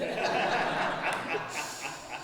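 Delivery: long breathy laugh; real laugh. Real, not acted. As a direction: laugh it out after your own aside. Laughs at her own aside.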